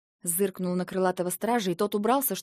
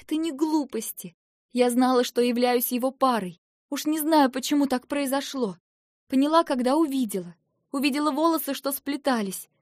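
near tears; 6 semitones higher, 5 dB louder than usual. Acted. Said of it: "что сейчас разревусь".

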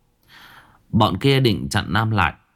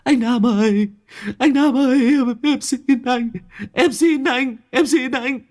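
crying plea. A narrator's reading. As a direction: wail for mercy. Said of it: "chỉ biết khóc lóc".

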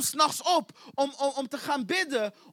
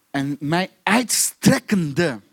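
low, loud and very slow; weighty, elongated preaching delivery; stretched out; 8 semitones lower, 6 dB louder, much slower than usual. Shown as a demonstration. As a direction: draw out "uitstrekkende" syllable by syllable.

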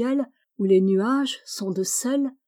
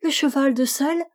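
as if to convince; 5 semitones higher than usual. A narrator's reading. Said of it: "comme pour convaincre".

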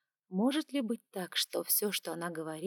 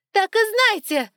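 shouting; 14 semitones higher, 14 dB louder, slower than usual. A narrator's reading. High priestess keeps shouting.

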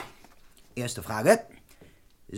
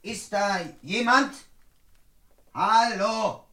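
calling out; 9 semitones higher, slower than usual. Acted. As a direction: call out to whoever hides there. Calling onto dark terrace.

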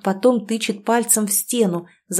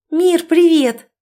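high, loud, slow greeting; forced cheer; 8 semitones higher, 6 dB louder, slower than usual. A narrator's reading. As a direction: burst in overly bright. Sister bursts in cheerfully.

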